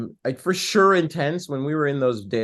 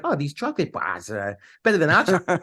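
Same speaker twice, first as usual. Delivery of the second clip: higher, hammed-up Hanover-style TV pitchman; commercial guy accent; 4 semitones higher than usual. Actor imitating the chocolate commercial guy from growing up, mock accent. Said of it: "commercials where the guy's like".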